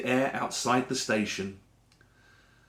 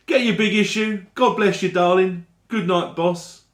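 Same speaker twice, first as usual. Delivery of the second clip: higher, brighter call of a street vendor hawking magazines; cheery street seller; 5 semitones higher, 3 dB louder than usual. Acted. Big issue seller calling to commuters, cheery higher patter. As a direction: sing out the patter to passing commuters.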